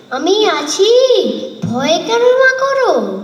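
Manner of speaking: put-on high, eager boyish call to mother; a child puffing up to protect his mother; brave reassurance. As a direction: sound the little hero, bold and bright. Boy reassures mother against bandits.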